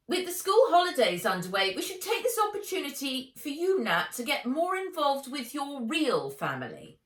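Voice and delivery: high, loud, prim aunt voice; a cold, superior aunt laying down the law; fast, lofty, domineering aunt; haughty aunt. A narrator's reading. Aunt Vera's voice.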